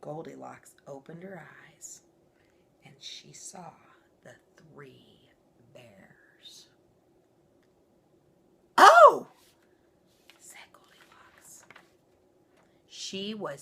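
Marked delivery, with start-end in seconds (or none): 0.0-6.7 hushed storytelling whisper; suspenseful whisper. A narrator's reading. Narrator whispering as Goldilocks wakes to the bears. 8.8-9.3 very high loud gasp of surprise; startled cry. Acted. Goldilocks waking to see the bears, a shocked yelp. 10.4-11.6 hushed aside whisper. A narrator's reading. Narrator whispering after Goldilocks' cry.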